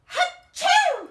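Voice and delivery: giant acted sneeze, loud and high; huge sneeze. Wolf sneezing the straw house down, huge loud sneeze. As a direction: blast out a theatrical achoo.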